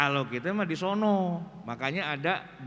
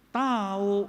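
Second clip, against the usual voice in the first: "Tahu" with a drawn-out vowel; Betawi villager reply; 3 semitones higher, 3 dB louder than usual. Retold or imitated. Villager answering a Javanese visitor, drawn-out reply. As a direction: answer "Tahu" long, meaning you don't know. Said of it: "orang Jawa datang ... nanya begini".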